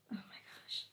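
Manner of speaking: whispered oh my gosh. Real, not acted. Noticing fur everywhere, under her breath in a whisper.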